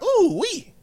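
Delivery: high delighted ooh-wee; impressed. Retold or imitated. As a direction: gush over it, amused. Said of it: "to be like".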